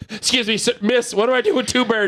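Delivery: high, fretful pretend zoo-goer; mock helpless visitor. Pretending to ask zoo staff about two birds, high and fretful.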